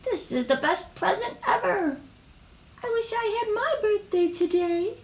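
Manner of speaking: high-pitched party-guest voice; delighted little kid, way up high; acted childish birthday glee; excited child.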